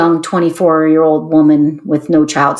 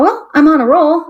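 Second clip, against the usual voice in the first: high, bright-spirited aside; mock daring, pitched up; emboldened; 8 semitones higher than usual. Telling the interviewer her plan, with a high pitched-up lift.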